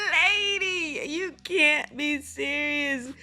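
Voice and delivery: high, drawn-out exasperated disbelief; incredulous. Real, not acted. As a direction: stretch it out, appalled and half laughing. Reacting in disbelief to clip.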